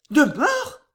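high, alarmed woman's question; frightened. A narrator's reading. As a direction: gasp it back as a high, fearful question. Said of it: "dit-elle encore plus effrayée". (question effrayée, voix aiguë)

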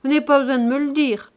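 mildly exclaimed, high and fast; exclaiming. A narrator's reading. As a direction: answer back briskly and loudly. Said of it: "s'écria Nanon".

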